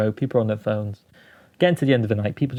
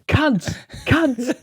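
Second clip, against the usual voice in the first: imitated persistent heckler; 13 semitones higher than usual. Heckler interrupting five minutes into the set, high mock calls. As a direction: mimic a heckler who won't stop. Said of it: "he just kept going".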